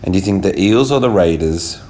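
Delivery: usual speaking voice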